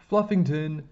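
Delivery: loud, high cry of alarm; shouted call to a runaway cat; shouting. A narrator's reading. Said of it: "Mister Banner shouted".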